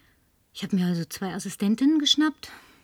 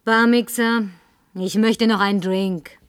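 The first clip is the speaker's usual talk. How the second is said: laut und fordernd; lautes betrunkenes Fordern; 6 dB louder than usual. Acted. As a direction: den Barmann anherrschen. Betrunken fordert sie Drinks. (verlangt betrunken und laut nach der Flasche)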